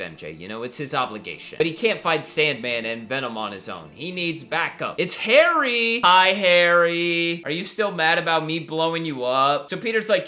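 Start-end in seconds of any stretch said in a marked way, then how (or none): 5.0-7.4 drawn-out sing-song hello; mock cheery greeting. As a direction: stretch the name with playful false cheer.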